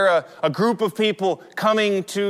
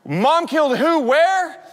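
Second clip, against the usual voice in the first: high-pitched, incredulous questions; mock-bewildered double question; baffled disbelief; 9 semitones higher than usual. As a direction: act out being totally confused, pitch shooting up. Retells own baffled reaction to news.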